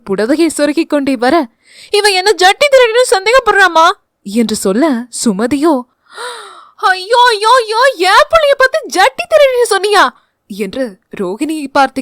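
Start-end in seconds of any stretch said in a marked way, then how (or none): 1.9-3.9 put-on high, loud, whiny young woman's voice; shrill complaint. Daughter complaining loudly to mother. 6.9-10.0 high, loud, fast wailing auntie; outraged wailing. Acted.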